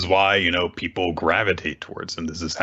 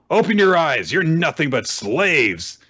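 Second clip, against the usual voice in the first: higher, drawn-out rebuke of the crowd; retold rallying cry, slowing on the last words; defiant speech; 7 semitones higher than usual. Luffy throws himself at the prisoners and challenges them, defiant.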